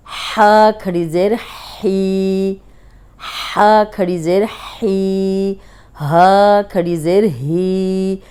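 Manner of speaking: हा and ही drawn out slowly, breathy h; slow letter drill. Shown as a demonstration. Teacher drilling students on the light ही sound. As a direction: stretch each ही slowly. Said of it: "हा की practice करनी है".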